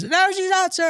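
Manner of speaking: high-pitched mock protest yell; screaming recruit. Recruit contradicting the drill instructor about his wife, high mock scream. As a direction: imitate a recruit yelling back in a very high voice.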